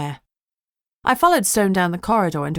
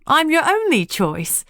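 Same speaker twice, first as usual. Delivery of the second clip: snorting retort; 11 semitones higher, 4 dB louder than usual. A narrator's reading. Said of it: "Lucy snorted".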